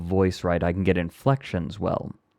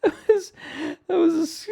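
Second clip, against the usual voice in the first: laughing; 4 dB louder, faster than usual. Real, not acted. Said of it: "squeaky laughing".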